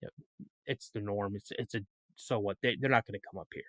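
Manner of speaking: the farm dog voiced as utterly unimpressed; bored dog's thoughts. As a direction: voice the dog shrugging off the coyotes. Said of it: "looked at him like".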